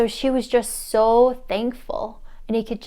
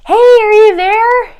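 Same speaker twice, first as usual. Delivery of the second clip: loud, high holler; calling out; 12 semitones higher, 14 dB louder than usual. Retold or imitated. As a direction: holler from the car window. Calling from car window.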